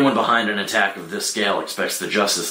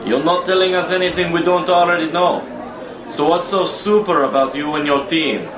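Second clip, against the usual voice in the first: angry challenge; 8 semitones higher, 3 dB louder than usual. Acted. Angry official confronts Superman.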